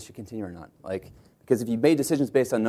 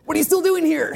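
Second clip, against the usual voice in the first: much higher and louder, exasperated outburst; Paul Graham exasperated; 5 dB louder than usual. Retold or imitated. Mentor yelling at founders to leave, high exasperated imitation. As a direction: blurt it out in disbelief. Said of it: "I'll try to do my best Paul Graham impersonation".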